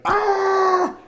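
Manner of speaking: very high, loud imitated shriek; long piercing mock scream of a scalded child; mock child's scream. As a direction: imitate a little kid screaming. Scalded little boy cries out.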